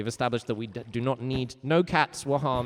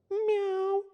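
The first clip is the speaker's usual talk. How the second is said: much higher squeaked meow; tiny squeaky meow. Shown as a demonstration. Teacher demonstrating the cat sound for chat. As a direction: meow like a little kitten.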